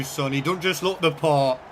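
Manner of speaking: normal talking voice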